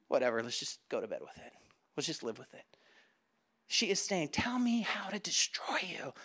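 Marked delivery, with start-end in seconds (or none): 0.1-2.6 low, soft, tossed-off murmur; resigned shrug. Acted. Us giving in to compromise, resigned and offhand. 4.3-6.1 slow, breath-only whisper; seductive whisper. Acted. Delilah luring Samson, slow whisper.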